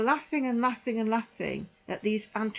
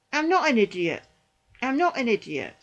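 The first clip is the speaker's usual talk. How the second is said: ordinary talk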